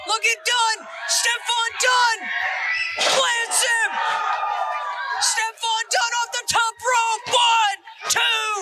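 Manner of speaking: high, shouted title-win call; excited shouting. Real, not acted. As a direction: yell the win with full excitement. Title change, commentators yelling.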